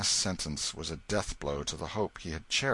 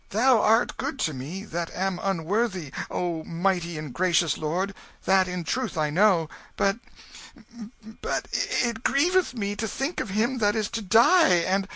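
put-on higher, pleading young voice; anxious boy; 13 semitones higher, 5 dB louder than usual. A narrator's reading. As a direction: plead gently as a frightened boy. The boy answers the king.

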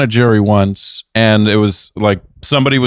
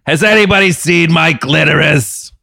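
Mo the bartender; 5 semitones higher than usual. Pretend. Bartender asking if anybody's seen him, mock bartender call. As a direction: shout a prank name like a clueless barkeep. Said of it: "Fucking Mo the bartender over here".

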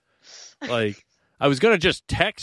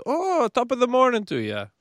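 higher-pitched, mock Irish greeting; put-on Irish accent; 11 semitones higher than usual. Husband showing off his accent to friends, put-on Irish accent. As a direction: play up an Irish lilt. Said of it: "bursts into these accents".

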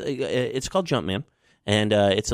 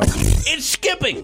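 annoyed drawn-out complaint; 12 semitones higher, much slower than usual. Acted. Artist angry at botched track.

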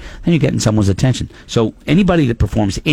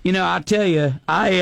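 Southern accent; 8 semitones higher, 3 dB quieter than usual. Shown as a demonstration. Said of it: "someone's from the South".

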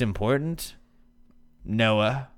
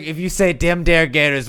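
mock scolding; 5 semitones higher, 5 dB louder than usual. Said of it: "She's like".

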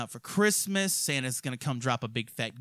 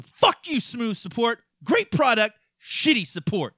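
heated outburst; 7 semitones higher, 4 dB louder, slower than usual. Real, not acted. Cursing out the support team.

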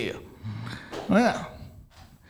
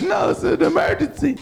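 urgent mock lady voice; 8 semitones higher than usual. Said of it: "And she was like".

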